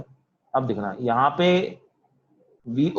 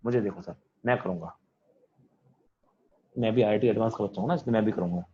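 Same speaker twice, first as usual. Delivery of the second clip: imitating a keen student, lower and softer; a lightly voiced eager kid volunteering in a low, soft voice; eager IIT topper; 5 semitones lower, 4 dB quieter than usual. Teacher imitating the keen IIT Advanced student, lower and softer. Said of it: "IIT advance निकालने वाला बच्चा आगे और वो बोलता है".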